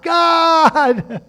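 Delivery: frantic high shout for help; desperate cry from inside the fish; crying out to God. Acted. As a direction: yell it like a drowning man begging God. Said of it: "he starts crying out to God".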